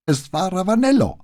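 hoch gepresst, den Tränen nah; hohe, gepresste Stimme. A narrator's reading. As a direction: mit enger Kehle herauspressen. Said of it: "mit hoher, gepresster Stimme, offenbar den Tränen nah". (gepresst und weinerlich)

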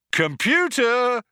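high, drawn-out shout. A narrator's reading. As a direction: call out loudly to the computer. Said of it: "shouted Zaphod".

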